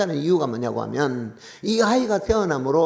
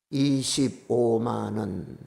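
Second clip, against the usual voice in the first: drawn out very slowly, deadpan; 6 semitones lower, much slower than usual. Retold or imitated. A father reading his son's terse money text, slow and deadpan. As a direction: spell out each syllable like a flat text message.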